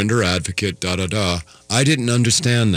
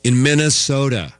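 real disbelief, higher and a bit louder; incredulous; 6 semitones higher, 3 dB louder than usual. Real, not acted. To listeners, echoing the state name, incredulous. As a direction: repeat it as if you can't believe it. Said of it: "sound like it's a little incredulous".

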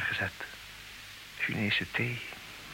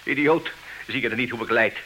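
anguished anger; 4 semitones higher, 3 dB louder than usual. Acted. Charles flares up.

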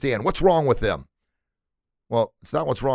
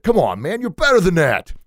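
scolding himself; 9 semitones higher, 7 dB louder than usual. Retold or imitated. Scolding his past self.

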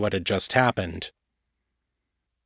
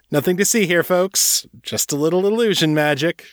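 higher-pitched jokey cover-up to a crowd; playful showman; 9 semitones higher than usual. A narrator's reading. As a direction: brush it off like a stage magician. Grinning cover story to crowd.

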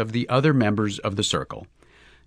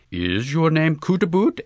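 ordinary delivery, nothing marked

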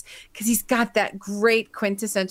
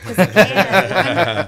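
slow, louder, laughing through it; 3 semitones lower, 7 dB louder, much slower than usual. Real, not acted. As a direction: tease back with a laugh. Teasing reply, laughing.

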